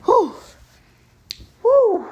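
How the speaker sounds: loud, high whoops of exhaled breath; winded whew. Real, not acted. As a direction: blow out a big high "woo" to recover. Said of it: "Woo, out of breath".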